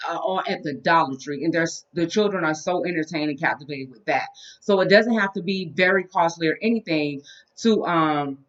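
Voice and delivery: ordinary delivery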